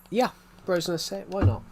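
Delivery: ordinary talk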